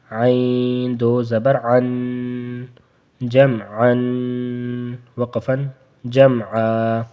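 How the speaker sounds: slow, drawn-out جمعن then جمعا; slow recitation; spelling drill. Shown as a demonstration. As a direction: sound out slowly. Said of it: "spelling drill".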